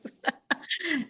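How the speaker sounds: quiet, trailing chuckle; soft laugh. Real, not acted. Singer excuses her voice to the group, soft laugh. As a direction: laugh it off softly.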